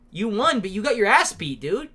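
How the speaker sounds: normal talking voice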